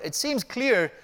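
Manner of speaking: high, loud, declaiming the quotation; reading a quote aloud. Retold or imitated. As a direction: proclaim the scholar's words with force. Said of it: "This is what he says, quote".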